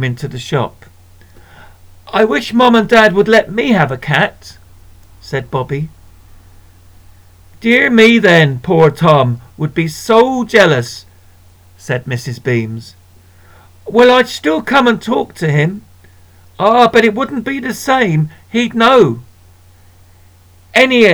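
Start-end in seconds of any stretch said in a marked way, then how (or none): 2.1-4.6 put-on higher, longing child's voice; wistful boy. Bobby to the shopkeeper, higher wistful boy. 7.6-11.1 cosy shopkeeper. Acted. Missus Beams voiced. 13.9-19.2 put-on higher, eager child's pleading. Boy answering the shopkeeper, higher child voice.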